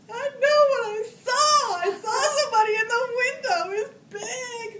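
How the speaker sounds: very high, very loud crying protest; tearful insisting. Retold or imitated. Scared child insisting to the officer, crying loudly. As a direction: wail it like a scared kid nobody believes.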